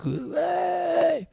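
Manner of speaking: very high comic scream; mock shriek. People seeing the missing guy walk back, mock shriek. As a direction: let out a squealing, hammed-up yelp. Acting shock at his return.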